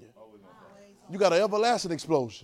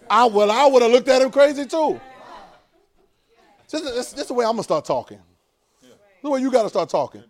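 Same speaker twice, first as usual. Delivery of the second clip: full-force preaching; 5 semitones higher, 10 dB louder than usual. Acted.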